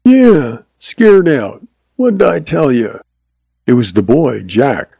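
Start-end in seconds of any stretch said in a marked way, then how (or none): 0.0-3.0 put-on high-pitched taunting boy; mocking, high youngster's voice; jeering kid. A narrator's reading. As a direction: jeer like a kid proved right. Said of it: "looked into the kid's jeering face".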